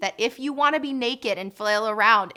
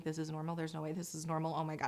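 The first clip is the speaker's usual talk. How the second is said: panicked partner; 5 semitones lower, 11 dB quieter, faster than usual. Retold or imitated. Partner panicking in the labor room.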